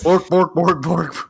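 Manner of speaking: lightly voiced cartoon chef babble; Swedish Chef. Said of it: "you think there's Swedish Chef".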